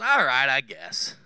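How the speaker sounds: reluctant God. Pretend. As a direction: give in with a sigh of reluctance. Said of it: "And he goes".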